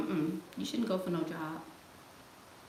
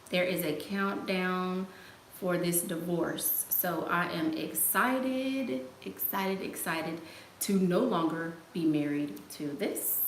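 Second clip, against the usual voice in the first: measured, drawn-out declaration; slow, deliberate announcement; slower than usual. Real, not acted. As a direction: spell it out slowly, word by word. Deliberate announcement of divorce countdown.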